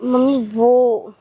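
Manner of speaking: low, slow, faltering excuse; sheepish daughter facing her mother; cornered hesitation. Acted. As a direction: drag it out, unable to confess.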